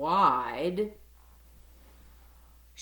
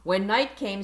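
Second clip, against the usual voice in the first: ordinary delivery, nothing marked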